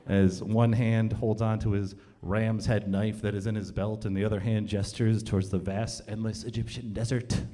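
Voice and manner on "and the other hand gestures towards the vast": usual speaking voice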